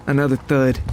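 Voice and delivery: ordinary delivery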